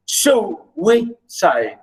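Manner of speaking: "suicide" broken slowly into su, i, cide; syllable by syllable. Shown as a demonstration. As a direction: spell out the three syllables of "suicide". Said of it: "Notice the three syllables".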